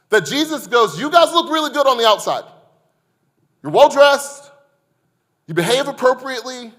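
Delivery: fervent pulpit delivery; impassioned preaching. Acted. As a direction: press each line home to the crowd.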